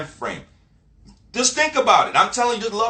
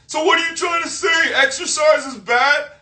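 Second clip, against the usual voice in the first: high, loud mocking imitation of an accuser; mock indignant comeback; 10 semitones higher, 4 dB louder than usual. Friend twists his encouragement into negative, shrill mock indignation. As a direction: shout it high, dragging out the last question.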